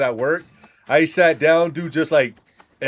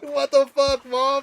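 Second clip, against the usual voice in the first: much higher, incredulous cry; exasperated; 14 semitones higher, slower than usual. Retold or imitated. Son exasperated at his mom, incredulous. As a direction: throw up your hands at her. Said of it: "I'm like".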